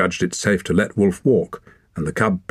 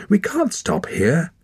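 urgent; 11 semitones higher, slower than usual. A narrator's reading. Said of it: "cried Wren".

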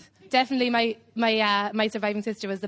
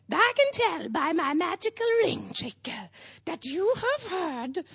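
higher, slow, otherworldly witch voice; mystical witch voice; 8 semitones higher, slower than usual. Acted. Comedian doing the psychic witch's spooky voice for the crowd. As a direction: intone it like a spooky fortune teller. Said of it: "Do you know she doesn't talk like that?".

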